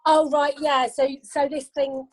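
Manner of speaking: ordinary delivery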